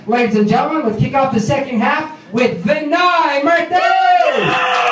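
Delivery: announcer introduction. Real, not acted. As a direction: build to a drawn-out, high-pitched name. Host introduces next comedian.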